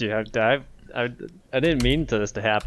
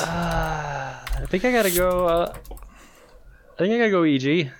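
slow, whining, higher drawl; whiny reluctance; 5 semitones higher, slower than usual. Pretend. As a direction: groan out a reluctant pick. Reluctant pick, against co-host.

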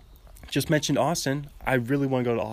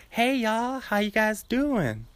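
higher, slow cartoon drawl; Family Guy character like Matthew McConaughey; 8 semitones higher, slower than usual. Imitating a Family Guy character greeting people, high drawl. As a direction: sing out a drawn-out, camp Southern greeting. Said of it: "he kinda sounds like Matthew McConaughey".

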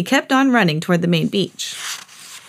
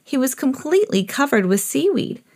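plain reading voice

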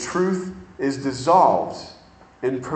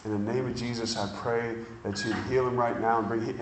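quiet prayer; 6 semitones lower, 9 dB quieter, faster than usual. Retold or imitated. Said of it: "she just goes".

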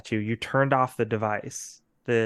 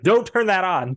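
urgent warning; 6 semitones higher, 4 dB louder than usual. Retold or imitated. Warning about the device.

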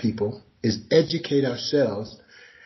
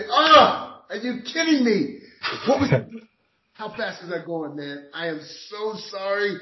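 high, bright apologetic gush to the officer; friendly surprise; 8 semitones higher than usual. Retold or imitated. Driver greeting the officer at the window, high and apologetic. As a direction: act stunned and sorry, pitch way up.

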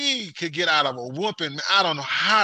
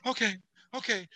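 soft mock crying; 4 semitones higher, 7 dB quieter than usual. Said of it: "she start crying".

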